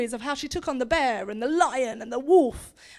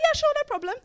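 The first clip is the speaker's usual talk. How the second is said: very high, sing-out agreement; cocky nonchalance; 11 semitones higher, much faster than usual. Acted. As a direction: breezily accept the threat, pitched right up. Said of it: "Davita was like".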